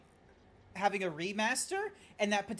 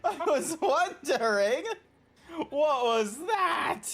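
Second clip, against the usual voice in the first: laughing disbelief; 8 semitones higher, 6 dB louder, slower than usual. Real, not acted. Realizes the NPC attempt, laughing.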